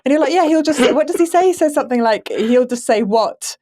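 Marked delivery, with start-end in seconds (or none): none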